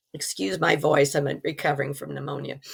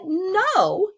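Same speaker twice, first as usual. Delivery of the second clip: retold shout; 13 semitones higher than usual. Woman refuses to be thrown out of heaven, retold high yell. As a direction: protest in a high, held-back cry. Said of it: "at the top of my lungs".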